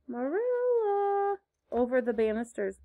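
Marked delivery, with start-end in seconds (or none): 0.1-1.4 high, drawn-out plaintive call. A narrator's reading. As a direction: call out plaintively, stretching the name. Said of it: "a plaintive voice calling".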